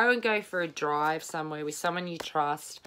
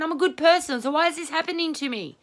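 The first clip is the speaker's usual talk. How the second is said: high, loud, pleading question; high, fast, desperate imitated wail; anguished plea; 12 semitones higher, 5 dB louder, faster than usual. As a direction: cry out to the sky at the unfairness. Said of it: "you're saying".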